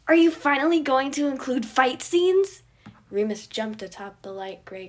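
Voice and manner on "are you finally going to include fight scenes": put-on loud, high, eager little boy; excited kid voice. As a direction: demand it like a thrilled child.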